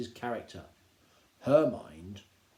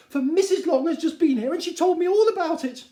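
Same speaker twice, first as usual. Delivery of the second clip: shrill-voiced Mrs Bennet; 11 dB louder than usual. Wife calling to her husband, high and gushing. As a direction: gush it like a fussy excited wife. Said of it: "returned she".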